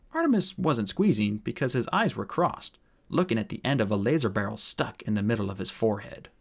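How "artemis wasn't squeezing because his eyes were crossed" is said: ordinary delivery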